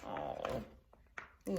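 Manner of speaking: plain reading voice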